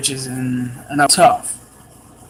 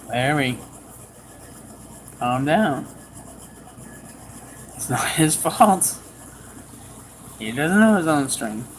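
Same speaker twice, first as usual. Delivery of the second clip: gently calm an angry husband; kind words; 5 dB quieter than usual. Retold or imitated. Mother calming the furious father, soft and kind. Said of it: "spoke kind words".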